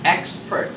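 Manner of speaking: expert with a crisp ks, throat closed. Shown as a demonstration. Teacher modelling expert with the x as ks. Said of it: "I close my throat".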